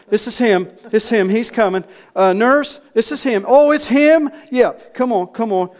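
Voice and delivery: loud, high-pitched urgent calls; excited announcing. Acted. Man tells the nurse that this is him, excited shouting. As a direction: herald his arrival to everyone in the corridor.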